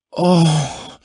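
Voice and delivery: high, loud moan of pleasure. Acted. Woman with her lover, moaning high and loud. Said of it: "she moaned".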